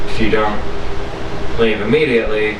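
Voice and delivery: ordinary delivery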